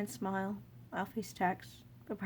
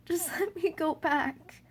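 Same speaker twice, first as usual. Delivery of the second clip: slow, higher sobbing plea; 9 semitones higher, 6 dB louder, slower than usual. Acted. Child begging the king, sobbing. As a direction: beg through sobs, much higher. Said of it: "you sob".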